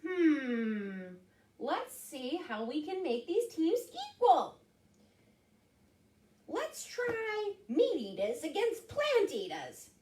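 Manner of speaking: high cartoon rabbit; high, animated rabbit character. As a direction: think it over as a storybook bunny. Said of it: "said Rabbit".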